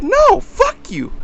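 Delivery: high, drawn-out indignant mock outburst; defiant refusal. Buyer refusing the man's demand, indignant outburst. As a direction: fire back high and stretched out.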